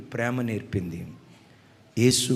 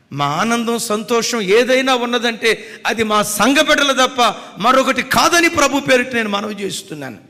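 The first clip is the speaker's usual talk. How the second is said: high, loud preaching cry; fervent preaching; 9 semitones higher, 12 dB louder than usual. Acted. Preacher proclaiming to the congregation in a loud fervent cry.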